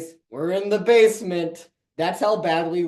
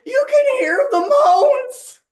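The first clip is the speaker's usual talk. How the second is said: high, slow Shaggy whisper-shout; 7 dB louder, slower than usual. Retold or imitated. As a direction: lean in like Shaggy, much higher. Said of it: "That was the impression".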